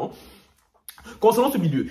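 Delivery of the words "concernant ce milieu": ordinary talk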